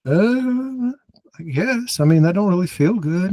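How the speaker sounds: high, weak, dazed voice; a high, woozy mumble from someone about to pass out; dazed and unwell. Retold or imitated. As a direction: squeak it out like you're fading. Retold self feeling unwell.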